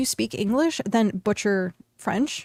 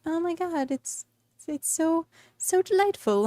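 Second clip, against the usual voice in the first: mock gushing tourist; 8 semitones higher than usual. Mocking Paris fans, high and swooning. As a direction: ham up the delighted romantic, pitched high.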